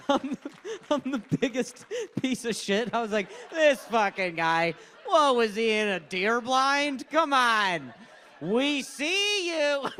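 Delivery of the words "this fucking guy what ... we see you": loud, drawn-out heckle, rising higher; stretched, incredulous jeer; mocking call-out. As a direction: call him out slowly across the room. Acts out his mocking heckle.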